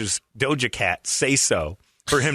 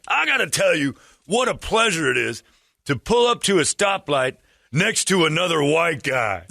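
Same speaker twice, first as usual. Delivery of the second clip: lightly voiced proud stranger; gun guy quote; 5 semitones higher than usual. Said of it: "that's not it".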